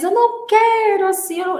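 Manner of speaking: put-on high, loud child's whine; mock tearful protest. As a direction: wail the refusal like an upset child. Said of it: "Eu não quero desse jeito".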